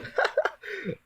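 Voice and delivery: high-pitched burst of laughter. Real, not acted. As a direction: squeal with laughter. Laughing at recording mishap.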